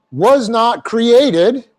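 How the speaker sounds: solemn pounding emphasis; slow emphasis. Shown as a demonstration. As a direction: drag out "was not created" word by word. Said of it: "in the emphatic position".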